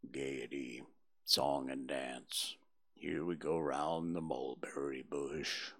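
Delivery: very low, slow and mournful Eeyore; gloomy donkey. A narrator's reading. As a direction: drag it out like a sad old donkey. Eeyore mocks gaiety.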